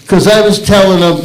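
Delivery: ordinary delivery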